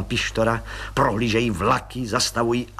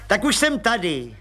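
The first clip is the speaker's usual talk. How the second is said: Pištora's voice; 6 semitones higher than usual. Acted.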